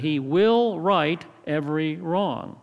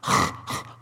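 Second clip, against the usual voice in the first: mimicked throat-scraping noise; a child copying a raspy 'kh' sound; imitated guttural rasp. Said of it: "She looks and she says".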